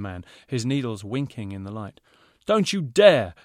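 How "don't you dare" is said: high, loud warning shout; angry shout. A narrator's reading. Designer yells at Hemi, angry shout. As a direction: yell it to stop him. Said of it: "fashion designer shouted".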